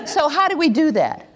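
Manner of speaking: normal talking voice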